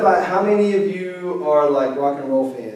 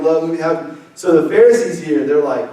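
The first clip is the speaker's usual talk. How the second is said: ordinary delivery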